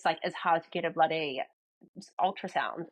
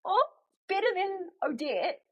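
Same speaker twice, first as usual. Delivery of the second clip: mock bright reaction; 14 semitones higher than usual. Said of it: "I was like".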